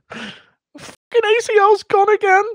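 squeaky defensive insistence; Darren Till excuse. Retold or imitated. Darren Till insists he let his daughter win, squeaky whine. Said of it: "Darren Till explaining".

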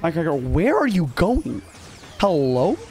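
mock-baffled calls with leaping pitch; playful disbelief, swooping up; incredulous questioning. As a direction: question your wayward unit like it has lost its mind. Scolds his straying game unit.

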